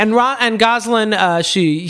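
ordinary delivery

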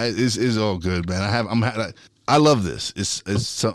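normal talking voice

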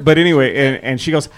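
normal talking voice

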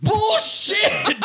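very high, drawn-out mock scream; hammed-up, high-pitched outburst; retold scream. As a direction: scream it through a laugh. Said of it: "And I screamed".

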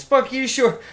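high-pitched mocking chirp; hockey players' chirp. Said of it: "all they ever say really is".

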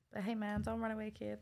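usual speaking voice

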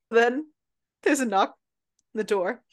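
ordinary talk

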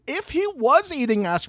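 ordinary talk, nothing marked

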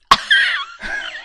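high laugh. Real, not acted. Laugh after Baptist joke.

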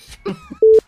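sudden squealing burst of laughter; high shrieking laugh. Real, not acted. Laughs at co-host.

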